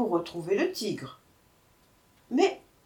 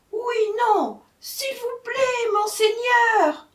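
very high, slow pleading wail; flustered jackal; 6 dB louder, slower than usual. A narrator's reading. Jackal confused before the tiger, pleading. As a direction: squeal a stretched-out plea.

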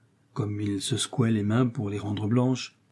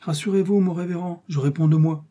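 higher-pitched, lightly voiced assurance; a hint of earnest young cleric; reassuring Aramis; 7 semitones higher than usual. A narrator's reading. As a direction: reassure the reverend, pitch lifted.

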